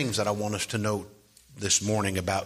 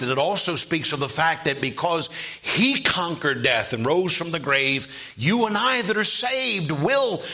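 raised, higher preacher's voice; fervent preaching; 5 semitones higher, 4 dB louder than usual. Real, not acted. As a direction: preach it with rising force, peaking on the promise. Preacher builds to full force.

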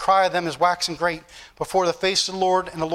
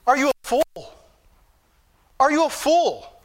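incredulous rebuke; 5 semitones higher than usual. Acted. Rebuking someone lingering in fire.

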